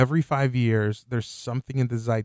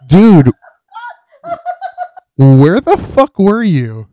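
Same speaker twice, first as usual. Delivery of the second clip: loud mock outrage; 8 semitones higher, 16 dB louder, much slower than usual. Real, not acted. Friend returns late, calling out.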